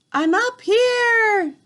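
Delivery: high, slow call from up the tree; shouted back. A narrator's reading. Boy in the tree shouts down to grandmother, high and loud. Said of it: "I shouted back".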